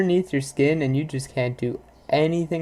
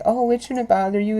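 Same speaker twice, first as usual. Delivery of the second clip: higher, dismissive mock voice; mocking others; 8 semitones higher than usual. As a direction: imitate a dismissive know-it-all. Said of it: "people are like".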